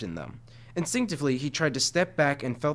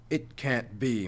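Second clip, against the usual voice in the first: usual speaking voice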